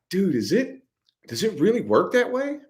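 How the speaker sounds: high, rising incredulous question; amazed disbelief. Acted. Marveling to himself that it works, high-pitched disbelief. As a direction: question it in wonder, pitched right up.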